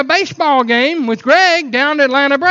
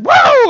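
high, loud woo; big joyful cheer; excited whoop; 14 semitones higher, 6 dB louder than usual. Acted. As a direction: whoop like a fired-up congregation. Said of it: "They get excited".

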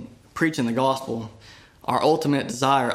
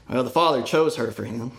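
ordinary delivery

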